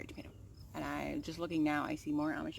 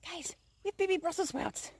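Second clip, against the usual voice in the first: excited; 10 semitones higher than usual. Real, not acted. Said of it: "Why is she freaking out?".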